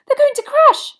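very high, loud cry of alarm; alarmed shriek. A narrator's reading. Girl watches two players plummet, alarmed shriek. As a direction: shriek it in panic. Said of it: "shrieked Hermione".

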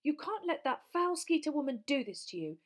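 high, loud exasperated shouting; urgent, indignant yelling; shouted. A narrator's reading. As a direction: shout through a shut door at someone hiding. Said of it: "Hermione shouted".

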